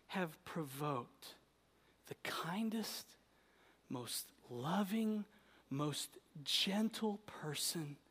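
slow hushed whisper. Acted.

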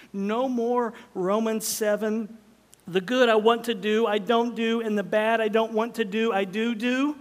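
hammering the point home; emphatic preaching. Real, not acted. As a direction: preach it with punch.

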